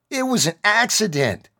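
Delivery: high, loud desperate holler. Acted. Cornered kid hollering at the soldier. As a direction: yell it out to the crowd. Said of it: "he hollered".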